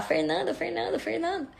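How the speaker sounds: high, softened imitation of people shouting; mock calling out. People calling Fernanda from the hall, mock calling out. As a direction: call the name high without real volume. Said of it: "começaram a gritar".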